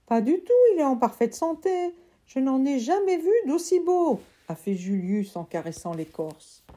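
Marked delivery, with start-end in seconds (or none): 0.1-4.2 much higher, loud delighted voice; thrilled uncle. Acted. Uncle thrilled by his sprouting seeds, high and loud. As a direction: burst with delight.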